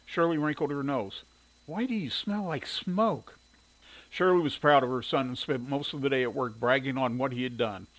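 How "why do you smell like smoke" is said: higher, drawn-out questioning mother; suspicious mother. A narrator's reading. Mother questioning her son after his route, suspicious.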